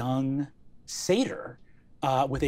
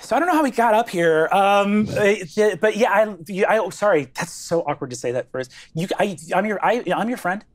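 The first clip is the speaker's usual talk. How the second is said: higher-pitched, stammering, restarting each phrase; flustered, awkward teen; 7 semitones higher, 7 dB louder, faster than usual. Acted. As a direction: trip over yourself accepting a new friendship. Flustered Zelda answers new friend.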